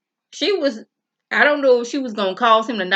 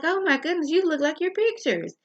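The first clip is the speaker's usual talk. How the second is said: high, gushing welcome, much higher voice; gushing new wife; 9 semitones higher than usual. Retold or imitated. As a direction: play the overly sweet stepmother meeting her stepdaughter. New wife greets the daughter.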